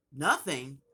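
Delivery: loud, indignant echo; mom flipping out. Retold or imitated. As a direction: throw it back in mock outrage. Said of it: "flipped out".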